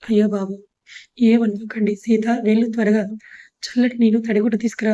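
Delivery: usual speaking voice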